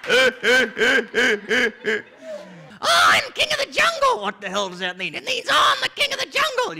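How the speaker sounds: proud, loud lion voice; boastful lion. Acted. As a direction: puff up like a king lion.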